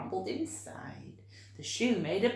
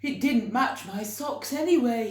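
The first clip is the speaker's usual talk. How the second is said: giant's cheerful aside; 5 semitones higher, 9 dB louder than usual. Acted. George giving away tie.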